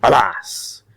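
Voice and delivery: hammed-up pirate captain voice; pirate talk. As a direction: play seafarers swapping words in thick pirate speak, building to a big rolling "arr". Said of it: "picture Koxinga talking with one of his admirals, and it might go something like this".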